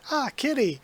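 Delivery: high, bright call to the cat; man happily spotting the stray cat, high call; delighted greeting. Acted.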